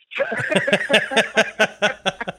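loud real laughter; laughing loud. Real, not acted. Laughs after mom quote.

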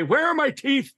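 put-on a doddering old president; hammed-up old man asking for his teeth; George Washington. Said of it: "George Washington would say".

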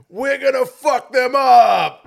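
slow loud bellowed retold shout. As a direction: belt it out like a fired-up player. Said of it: "and he goes".

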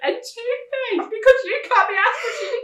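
high, slow, loud and laughing; mother complaining to her son about the wasted money, slow laughing exasperation. Retold or imitated. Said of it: "second time I've done it".

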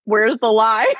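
pointed question, rising at the end; pointed retort. Retold or imitated. Answering an accusation of cyberbullying, pointed retort. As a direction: toss it back as a challenge. First take of her retort.

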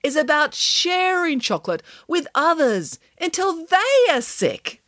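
high, slow, grand declaration; emphatic announcement, building to a drawn-out finish; announcing. A narrator's reading. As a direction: proclaim it, stretching the final words. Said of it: "announced Nanny Piggins".

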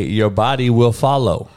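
ordinary delivery

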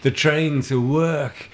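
high-pitched, shaky attempt at an Argentinian accent; mock-Argentinian accent; bad Argentinian accent. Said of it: "I'm great at accents".

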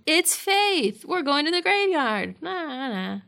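high, teasing quoted reply; playful mock announcement. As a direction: chirp it like a teasing retort. Acts out Buffy's cheeky announcement.

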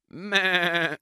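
bleated Meh, a little high; playful goat bleat. A narrator's reading. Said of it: "said Goat".

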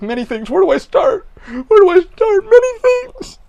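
high, loud theatrical cry; manic outburst. Retold or imitated. Weirdo answering someone who asked what happened, manic outburst. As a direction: wail it out at full pitch, over the top. Acts out his wild tangent reply.

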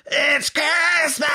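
a hammed-up rock-star yell. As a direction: screech it like the rock singer. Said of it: "[name] from Slade".

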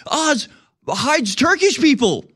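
high, breathless fake bombshell announcement; mock breaking news hype. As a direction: ham up a frantic alarmist poster. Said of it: "they're like".

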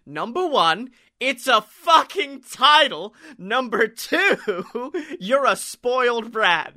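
put-on half-hearted Australian accent slipping away; Australian accent attempt. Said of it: "I'm gonna do her accent".

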